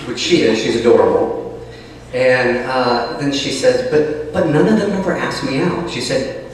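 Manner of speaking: ordinary delivery, nothing marked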